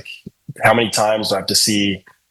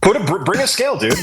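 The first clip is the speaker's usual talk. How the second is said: high-pitched comic demand; mock challenge; 8 semitones higher, faster than usual. Singer jokingly demanding a weigh-in, high mock challenge. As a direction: call for it in a high, insistent voice.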